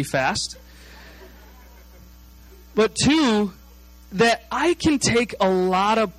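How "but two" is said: normal talking voice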